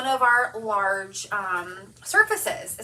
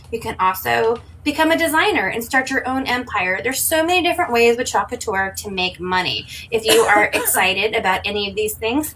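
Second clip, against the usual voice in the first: ad read; 8 dB louder than usual. Acted. Scripted promo intro.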